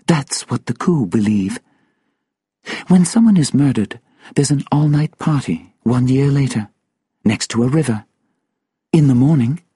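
high-pitched storyteller in character; solemn woman character. Acted. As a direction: tell it gravely, high and measured with pauses. Voices Sylvia's speech in the story.

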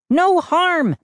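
high, indignant shriek; outraged shriek. A narrator's reading. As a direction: throw it back in a high shriek. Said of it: "Mindy shrieked".

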